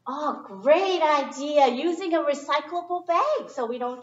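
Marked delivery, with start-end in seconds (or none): none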